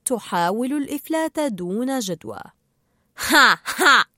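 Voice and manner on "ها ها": loud acted "ha, ha"; two loud, separate laughs. A narrator's reading. Marlin laughs at the trapped fish, loud laugh. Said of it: "ضحك مارلين".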